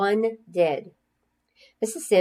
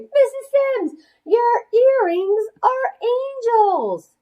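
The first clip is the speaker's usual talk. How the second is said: put-on very high, loud, slow child's shriek; excited scream; 5 dB louder, much slower than usual. A narrator's reading. Girl shrieking to her teacher about her earrings, high excited. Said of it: "practically screamed".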